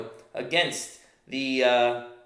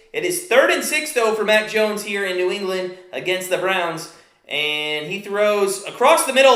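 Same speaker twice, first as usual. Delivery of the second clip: live football call, steady pace; play-by-play; 4 semitones higher, 4 dB louder than usual. Acted.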